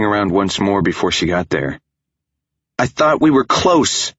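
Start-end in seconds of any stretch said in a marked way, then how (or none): 2.8-4.1 high, loud hurt yell. Acted. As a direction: shout it as she leaves. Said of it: "she yelled".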